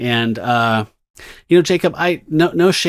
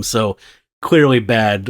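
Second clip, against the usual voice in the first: normal talking voice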